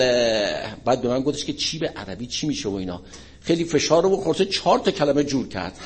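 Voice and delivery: ordinary talk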